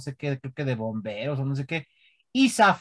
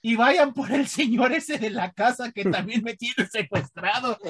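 put-on high, loud, slow kid protest; mock child yelling; 7 semitones higher, 3 dB louder, slower than usual. Child yelling at police that she's not his mom, high mock yell. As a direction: shout like a child lying to the police. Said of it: "Y yo gritando".

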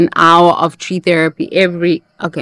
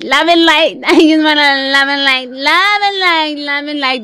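high, sing-song mock love and light; 8 semitones higher than usual. Mocking the love-and-light crowd to viewers, high sing-song parody. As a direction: parody the fake-positive crowd, airy and high.

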